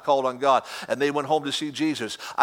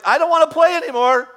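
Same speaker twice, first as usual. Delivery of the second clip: very high, loud hammed-up fleeing boy; mock panic of the boy on the altar; Isaac fleeing; 12 semitones higher, 6 dB louder than usual. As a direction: squeal it like a kid running off. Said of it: "I'm like".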